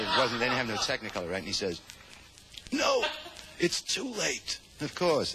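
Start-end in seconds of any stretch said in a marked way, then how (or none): 2.7-4.6 high cry then slow fading words; hammed-up dying voice. Dying Sonny telling his buddy it's too late, hammed-up. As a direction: play the dying hero, over the top.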